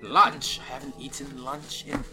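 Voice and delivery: lightly voiced ancient dwarf; a hint of a wistful old dwarf.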